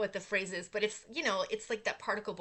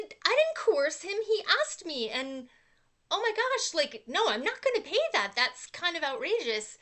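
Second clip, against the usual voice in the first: higher, louder exasperated pushback; retold indignant protest; 7 semitones higher, 6 dB louder than usual. Customer refusing the owner's phone demand, retold indignant protest. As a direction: replay an outraged refusal, pitched up. Acted back-and-forth quarrel on phone.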